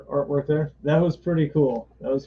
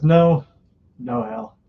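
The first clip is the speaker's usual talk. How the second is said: a sharp rebuffing no; firm refusal; 8 semitones higher, 6 dB louder, much slower than usual. Retold or imitated. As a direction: shut him down fast. Carol rebuffs Hal.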